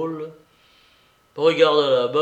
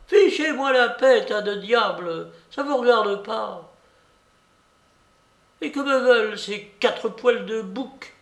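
put-on high, railing old-lady cry; full-voiced shouting; 8 semitones higher, 4 dB louder than usual. A narrator's reading. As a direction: shout them all away in fury. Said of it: "elle criait à pleine voix". (crier à pleine voix)

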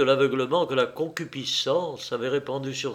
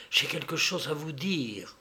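softer, secretive voice; hushed; 6 dB quieter than usual. Acted. Woman hushing the clergyman before a confession, softly. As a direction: drop your voice to share a secret.

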